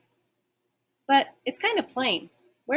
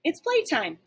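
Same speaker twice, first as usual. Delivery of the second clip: eager bark; 6 semitones higher than usual. Acted. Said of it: "I bark".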